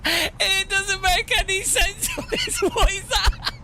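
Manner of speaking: high-pitched words squeezed out through helpless laughter; crying with laughter. Real, not acted. Streamer to chat over a silly replay, crying with laughter. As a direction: laugh so hard the words barely come out. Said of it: "I'm crying at how stupid that is".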